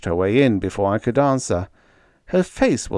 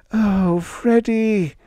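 much higher, very slow coo; 12 semitones higher, 3 dB louder, much slower than usual. A narrator's reading. Panda cooing to Freddie as she embraces him. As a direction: coo his name lovingly. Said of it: "she cooed".

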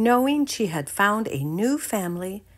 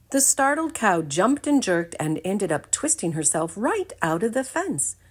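usual speaking voice

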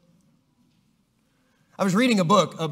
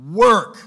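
"work" hit much louder after a pause; stress on work; 9 dB louder than usual. Shown as a demonstration. Reading the verse, teacher punches "work" loudly. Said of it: "I am emphasizing the word".

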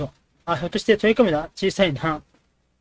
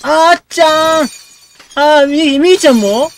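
loud, high, stretched cry of dismay; shocked outcry; 9 semitones higher, 10 dB louder, slower than usual. Real, not acted. Gamer wailing as the girls disappear, shocked and loud. Players all leaving, shocked.

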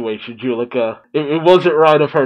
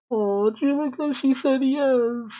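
tender high hush; 10 semitones higher, 6 dB quieter than usual. Real, not acted. Touched by character's answer.

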